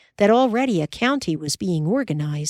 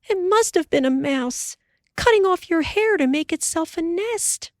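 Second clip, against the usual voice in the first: much higher wife's voice; Ma dismayed; 11 semitones higher than usual. Acted.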